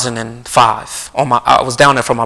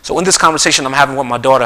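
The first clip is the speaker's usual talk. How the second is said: usual speaking voice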